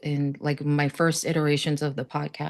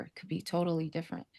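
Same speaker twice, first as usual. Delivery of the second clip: much softer, almost to herself; soft echo; 12 dB quieter, faster than usual. Real, not acted. Echoing her own hope to the guest, softly. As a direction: let the repeat fall away quietly. Repeats her hopeful point twice.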